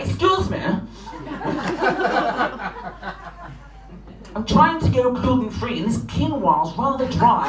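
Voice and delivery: prim, polite posh voice; posh polite fox. Acted. Surrey fox at the door, politely posh. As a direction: ask ever so politely. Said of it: "They knock on your door ... and go".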